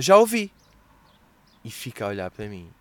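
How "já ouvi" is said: blunt dismissive snap; curt waitress. Retold or imitated. Waitress brushing off a customer's order, curt. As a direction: cut the customer off coldly. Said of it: "E ela".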